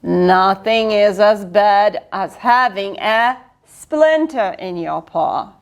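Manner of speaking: ordinary delivery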